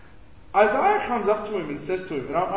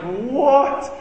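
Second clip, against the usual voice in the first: shocked what; 7 semitones higher, 6 dB louder than usual. Retold or imitated. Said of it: "We'd be like".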